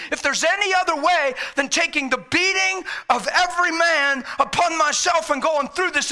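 high, loud, urgent preaching; fired-up, impassioned shouting. Real, not acted. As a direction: plead at full voice, pressing every clause. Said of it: "I'm a little fired up".

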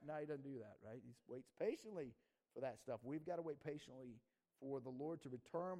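ordinary talk, nothing marked

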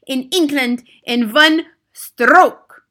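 loud, high, slow thundering. Acted. Grand High Witch thundering her plan to the witches, loud. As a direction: boom each word slowly. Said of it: "thundered the Grand High Witch".

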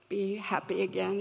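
usual speaking voice

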